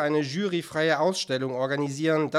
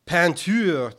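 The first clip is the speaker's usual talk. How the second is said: sharp, louder, raised echo; hissed retort; 4 semitones higher, 8 dB louder than usual. A narrator's reading. Artist interrupts the director, hissing the word back. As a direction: throw the word back with contempt. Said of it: "zischte Max".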